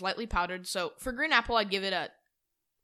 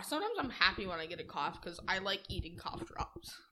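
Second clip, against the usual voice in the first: whispering; 5 dB quieter than usual. Real, not acted. Confessing guilty pleasure softly.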